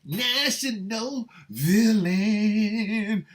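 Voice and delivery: high, slow, drawn-out showboating; hammed-up boast. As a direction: stretch it out like a grand title. Hams up being international.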